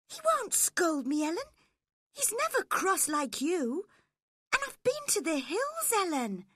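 put-on high girlish voice, pleading; a young girl defending herself, pitched high; spoilt young girl. Girl pleading with her nurse, high girlish voice.

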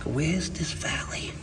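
hushed retold whisper. Designer whispering to the wallpaper hanger, hushed. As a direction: whisper it like a secret. Client whispering about the valley.

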